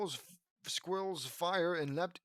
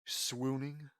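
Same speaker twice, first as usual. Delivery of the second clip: plain reading voice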